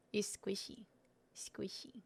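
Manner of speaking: breathy whisper, barely voiced. Real, not acted. Whispering while squeezing a soft snack. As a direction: hush it like a secret.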